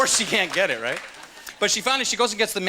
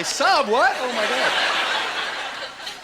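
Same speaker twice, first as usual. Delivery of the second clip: mock shock; 7 semitones higher, much slower than usual. Acts blindsided listener.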